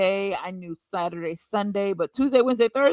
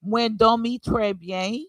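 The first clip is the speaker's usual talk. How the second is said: slow Creole with a foreign missionary accent, rising end; careful missionary Creole; 3 semitones higher, slower than usual. Shown as a demonstration. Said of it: "can you give us a small demonstration".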